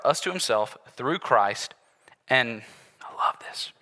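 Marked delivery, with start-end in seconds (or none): 2.3-3.7 breathy aside, barely voiced; whisper. Real, not acted. Pastor confides his delight to the congregation, whispered. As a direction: lean in and confide it.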